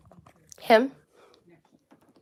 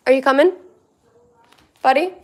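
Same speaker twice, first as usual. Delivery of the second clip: louder and higher, calling out; calling the dog; 7 semitones higher, 11 dB louder, faster than usual. Real, not acted. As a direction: call the dog from across the room.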